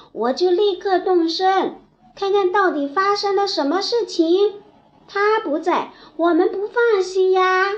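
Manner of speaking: anxious turtle calling out. Acted. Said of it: "伸着长长的脖子喊了起来". (着急地高声喊叫)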